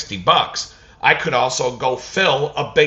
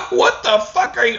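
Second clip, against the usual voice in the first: laughing disbelief; 3 dB louder than usual. Retold or imitated.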